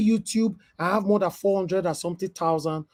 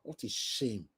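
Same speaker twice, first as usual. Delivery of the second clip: soft disgust; 7 semitones lower, 13 dB quieter than usual. Real, not acted. Contemptuous aside.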